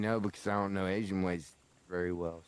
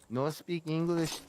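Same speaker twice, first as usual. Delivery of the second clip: mock broken English; 7 semitones higher than usual. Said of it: "you've definitely done at least the".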